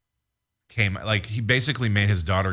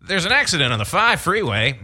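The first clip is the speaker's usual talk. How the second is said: high, loud chopper traffic report; traffic copter reporter; 9 semitones higher, 4 dB louder than usual. Pretend. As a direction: shout it over helicopter blades. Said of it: "the traffic copter gag".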